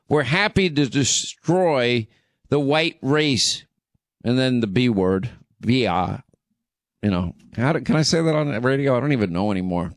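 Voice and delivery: ordinary delivery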